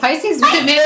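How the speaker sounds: loud, very high mock squeal; exaggerated high voice. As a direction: shriek it way up high, poking fun at yourself. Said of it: "my voice went up there".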